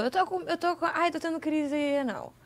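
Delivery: pained whimpering. Retold or imitated. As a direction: imitate a drawn-out, feeble moan. Imitating friend's whimpering in pain. (gemido fininho de dor, imitando)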